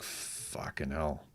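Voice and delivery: very soft, slow curse under the breath; muttered curse. Retold or imitated. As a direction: mutter it to yourself, dismayed. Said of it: "And I'm like".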